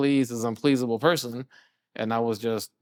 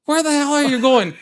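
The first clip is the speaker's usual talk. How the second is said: louder, much higher angry bellow; furious yell breaking into a laugh; 9 dB louder than usual. Retold or imitated. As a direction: yell at the boy walking out, then laugh it off. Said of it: "He's like".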